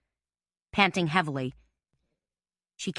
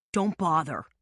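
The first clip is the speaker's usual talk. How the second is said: harsh. A narrator's reading. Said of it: "Billy said in a harsh voice".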